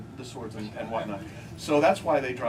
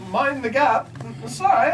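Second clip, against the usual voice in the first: higher, louder announcer voice; tube announcement; 8 semitones higher, 4 dB louder than usual. Retold or imitated. Comic imitating the tube's mind the gap announcement, higher and louder. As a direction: announce it like the London Underground. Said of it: "it'll say".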